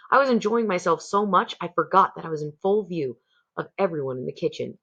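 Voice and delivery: ordinary delivery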